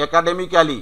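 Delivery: academically with a full "-ka-li" ending; spelt-out "e-ka-de-mi-KA-li" for academically; local mispronunciation. Shown as a demonstration. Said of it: "আমরা প্রচুর বলে থাকি এটা".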